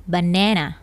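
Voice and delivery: American "banana", middle vowel like "neh"; American pronunciation. Shown as a demonstration. Teacher showing how Americans name it, "banana" with "neh". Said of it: "Jika American accent".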